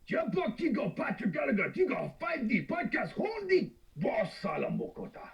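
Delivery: high, slow invented-language gibberish; made-up foreign language. Shown as a demonstration. Guest does his invented movie language for the host, pitched high. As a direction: chant made-up words up high. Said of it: "I made the language up".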